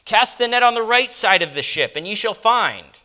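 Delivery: loud, high call across the water; calling from shore. Acted. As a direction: shout the command out to the boat. Jesus calls from the shore.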